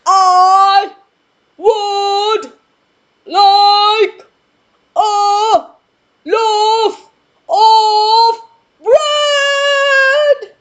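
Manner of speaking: each word shouted apart, very loud and high; word-by-word booming shout. Acted. Mister Noisy spacing out his order for the baker. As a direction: hammer every word out. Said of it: "I'll give it another shot".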